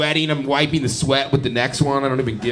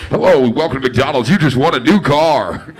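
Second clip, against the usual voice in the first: mock drive-thru greeting; 9 dB louder than usual. Pranking a drive-thru as employee.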